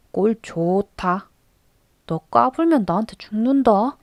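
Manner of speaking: teasing. A narrator's reading. Boy mocking Do-nyeom after the fight, teasing. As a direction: taunt the other boy, savoring it. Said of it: "도념을 놀리며".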